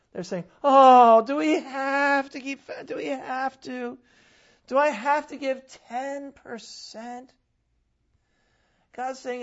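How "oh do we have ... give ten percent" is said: high, whining complaint; mock whining from the congregation; whiny mock protest. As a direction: whine like a reluctant giver. Mocking whining people.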